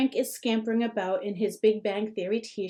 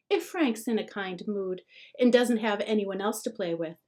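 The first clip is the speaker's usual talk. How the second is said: ordinary delivery, nothing marked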